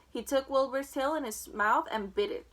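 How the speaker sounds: plain reading voice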